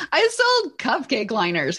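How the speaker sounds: ordinary talk, nothing marked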